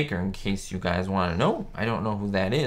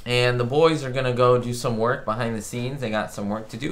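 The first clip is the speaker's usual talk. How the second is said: normal talking voice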